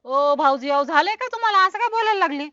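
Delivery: high, loud startled protest; alarmed. Acted. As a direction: cry out in shocked concern. Woman alarmed by his words.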